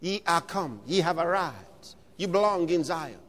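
ordinary talk

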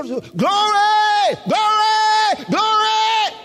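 high, loud, drawn-out cries of glory; shouting. Shown as a demonstration. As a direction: burst into repeated slow shouts of praise. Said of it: "why are they shouting?".

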